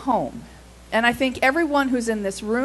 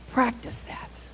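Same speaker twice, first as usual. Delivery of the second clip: whispered question; 7 dB quieter, slower than usual. Acted. Preacher whispering the question to the room. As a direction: breathe it as a whisper. Preacher asks congregation rhetorically.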